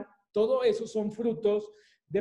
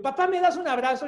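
put-on child asking; 7 semitones higher, 4 dB louder, faster than usual. Child asks dad for hug.

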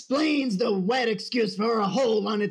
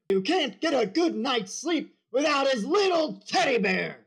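yelling drill sergeant; 2 semitones higher than usual. Acted. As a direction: bellow it like a boot camp sergeant.

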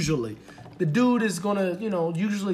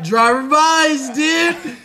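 loud, high, drawn-out holler; mock insistence; 9 semitones higher, 9 dB louder, much slower than usual. Date insisting the driver pays, slow loud mock holler. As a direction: stretch it out, imitating a stubborn date.

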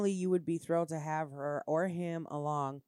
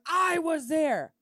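mock indignant boyfriend, higher, louder and slow; retold raised reply; 10 semitones higher, 8 dB louder, much slower than usual. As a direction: drawl it out like he's offended. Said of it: "He was like".

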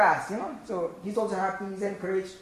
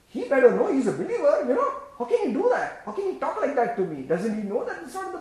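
higher, louder mock offended churchgoer with a laugh; mock indignation; 5 semitones higher, 5 dB louder than usual. Preacher mocking touchy believers, hammed-up indignation. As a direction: ham up the petty outrage.

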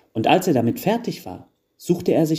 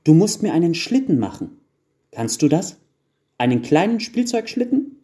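put-on high child voice climbing higher; eager elf child; 7 semitones higher, 4 dB louder than usual. A narrator's reading. Eldest child asking the carpenter for a sled, eager. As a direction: beg for a toy, pitch soaring. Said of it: "sagte das größte Wichtelkind".

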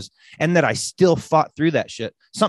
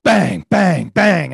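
mock banging; 3 semitones higher, 6 dB louder, much slower than usual. Imitating the witches pounding on the door.

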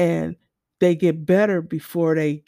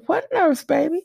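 put-on much higher, gentle "baby" to a child; tender nurse; 9 semitones higher than usual.

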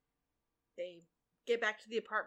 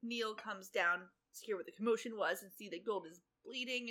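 ordinary delivery

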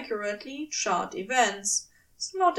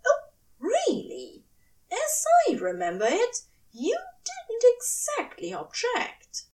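high, arch, annoyed query; prim sarcastic challenge; annoyed angel; 12 semitones higher than usual. A narrator's reading. Aziraphale challenges Crowley, high and arch.

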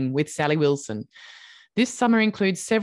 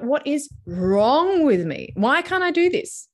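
drawn-out, rising mock exasperation; self-blaming frustration; 6 semitones higher, slower than usual. Host imitating a meditator blaming herself, exasperated. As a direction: voice someone frustrated with themselves, pitch climbing. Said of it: "you start blaming yourself 'cause you're like".

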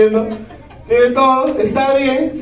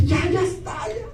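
triumphant cry; 7 semitones higher, 9 dB quieter than usual. Retold or imitated. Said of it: "Yo decía".

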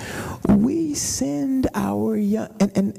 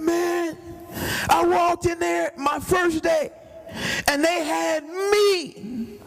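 high, aggrieved mock whine; hammed-up high complaint about scrubbing floors; complaining young man; 9 semitones higher than usual. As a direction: whine like a put-upon new hire.

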